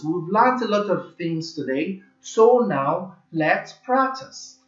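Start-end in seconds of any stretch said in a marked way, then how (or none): 2.2-4.6 slow drawn-out announcement. Real, not acted. Teacher announces practice.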